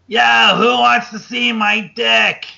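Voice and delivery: slow, high, loud mock promo yell; hammed-up drunken mic call; drunk wrestler on the mic. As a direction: ham up a drunk guy shouting to the crowd. Sandman on the mic.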